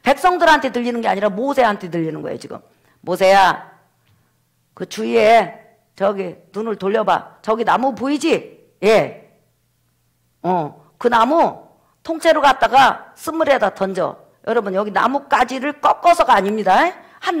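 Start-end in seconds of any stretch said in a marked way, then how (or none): 3.0-14.2 slow, measured divine instruction; voice of God. Acted. God showing Moses the tree, slow and commanding. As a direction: direct him unhurriedly, step by step. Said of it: "하나님께서 모세에게 음성을 들려주시는".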